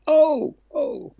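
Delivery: high, loud imitated "oh"; mock surprised reaction. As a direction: act out his startled realisation, pitched high. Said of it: "that was when he went".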